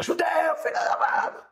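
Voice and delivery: high-pitched mock heckling shout; imitated antisemitic yell. Rabbi's son heckling a student from his car, mock yell. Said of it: "um judeu gritando com um judeu".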